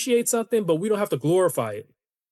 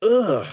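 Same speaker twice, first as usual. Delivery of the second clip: exasperated "ugh"; short groan of disgust; 3 semitones higher, 4 dB louder than usual. Real, not acted. Podcast host fed up with the haircut claim, disgusted groan.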